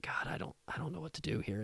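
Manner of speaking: quiet, half-whispered despair; broken prayer. Retold or imitated. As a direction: let the voice crack into a hushed plea. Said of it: "sobbing and crying".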